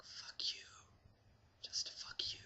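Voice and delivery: hissed whisper, barely audible; whispered curse. Retold or imitated. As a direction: whisper the insult so he can't hear. Cursing the cop under breath.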